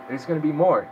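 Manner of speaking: ordinary delivery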